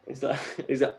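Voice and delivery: normal talking voice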